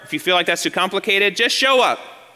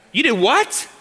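a higher, incredulous "You did what?" with a rising end; stunned wife; 6 semitones higher, slower than usual. Retold or imitated. Wife hearing husband bought a house, incredulous. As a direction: play the wife first hearing the news. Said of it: "What do you think your wife's gonna say?".

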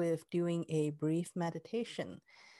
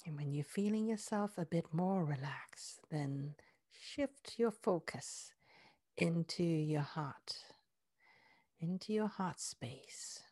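guided meditation; 2 semitones lower, 3 dB quieter than usual. Acted. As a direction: ease them inward with soft breathy words. Heart-focus guided meditation.

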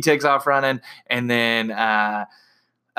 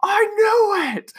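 mock Thor shout; slower than usual. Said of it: "Thor's like".

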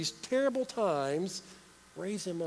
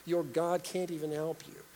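normal talking voice

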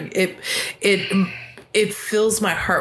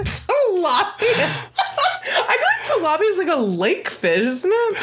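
high-pitched, cracking up; squeaky laughing speech; 13 semitones higher than usual. Real, not acted. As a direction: talk helplessly through laughter. Cracking up at the bad impression.

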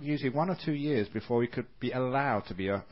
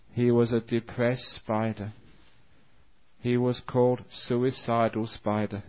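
usual speaking voice

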